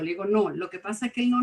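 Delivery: usual speaking voice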